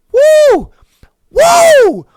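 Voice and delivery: high, loud hollered woo; excited woo. Acted. Podcaster hyping an imagined great voice, loud high woo. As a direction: whoop it out like a hype man.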